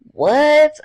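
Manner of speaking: high, loud shocked what; sudden high pitched what; reaction. Shown as a demonstration. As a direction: squeak it out in disbelief. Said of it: "if I say".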